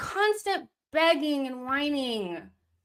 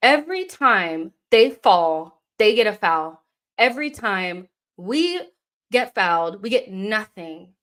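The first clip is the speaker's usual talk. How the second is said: angry rant; 7 dB louder than usual. Real, not acted. Ranting about fouls.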